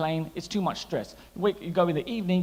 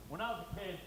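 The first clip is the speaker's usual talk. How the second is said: soft hero voice, higher and quieter; Jackie Chan quote; 4 semitones higher, 16 dB quieter than usual. Retold or imitated. Comic quoting Jackie Chan's film line in hushed voice. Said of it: "He said".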